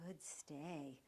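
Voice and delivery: low, soft praise; soft, even praise; calm praise. Shown as a demonstration. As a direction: praise him gently to keep him in place. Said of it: "keep your voice nice and calm".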